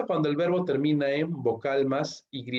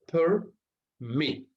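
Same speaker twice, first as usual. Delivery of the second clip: "permit" in two separated beats, higher pitched, per... MIT; syllables split, final stress; 5 semitones higher than usual. Shown as a demonstration. Teacher showing two syllables, "permit" split as per... MIT. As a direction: break "permit" after "per" and weight "mit". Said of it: "la mayor fuerza de voz".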